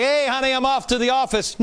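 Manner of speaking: high, bright mock goodbye to spouse; cheery commuter. Mock husband heading to the office, high and cheery. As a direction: play a cheerful husband leaving. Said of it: "it's not like".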